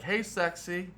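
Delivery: high-pitched teasing catcall; mock flirty greeting. Softball player greeting someone nearby, imitated flirty call.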